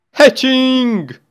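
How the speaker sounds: high, loud cheer; excited. Real, not acted. Player seeing the egg finally hatch, excited cheer.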